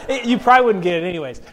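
amused, chuckling aside; laughing. Real, not acted. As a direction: talk through a real laugh. Laughing at his own story.

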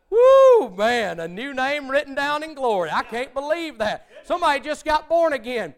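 loud high whoop of joy; excited whoop. Retold or imitated. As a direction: shout it out thrilled after church. Excited after church service.

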